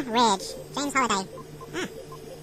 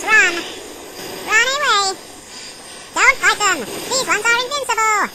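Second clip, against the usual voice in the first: urgent alarm; 6 semitones higher than usual. Real, not acted. Gamer fleeing cattle demons, urgent shouting. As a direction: warn them to run now.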